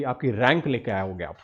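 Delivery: usual speaking voice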